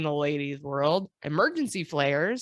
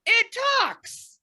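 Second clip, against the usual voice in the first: much higher, louder cry of wonder; amazed exclamation; 7 dB louder, much slower than usual. Acted. Brazilian emperor hearing the telephone, loud amazed cry. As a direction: gasp it out in astonishment. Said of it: "he picked up the other end and went".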